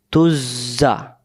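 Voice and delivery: তুজা said with the জ doubled and held. Shown as a demonstration. Teacher showing a doubled letter, slowly. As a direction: hold the জ in তুজা twice as long. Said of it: "সেখানে দুইবার উচ্চারণ হবে".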